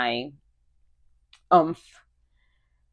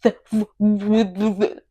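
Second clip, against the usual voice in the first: a short embarrassed laugh, pitched up; 5 semitones higher, 4 dB louder than usual. Real, not acted. Laughing at her own flop.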